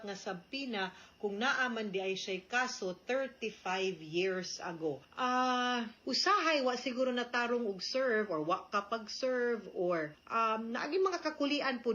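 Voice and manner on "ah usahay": usual speaking voice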